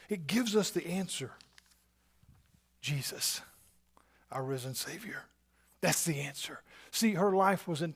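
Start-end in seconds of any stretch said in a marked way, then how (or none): 2.8-5.2 soft, hushed whisper of devotion; reverent whisper. Real, not acted. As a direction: breathe the name like a prayer. Reverent naming of Jesus.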